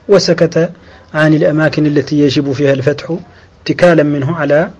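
ordinary talk, nothing marked

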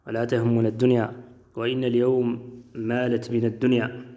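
normal talking voice

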